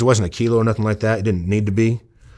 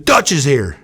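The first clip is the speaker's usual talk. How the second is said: calling out; 4 semitones higher, 4 dB louder, slower than usual. Retold or imitated. Calling out a visitor's arrival.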